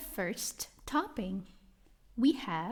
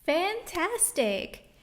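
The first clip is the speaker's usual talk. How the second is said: praise; 6 semitones higher, much slower than usual. Acted. Teacher praising children.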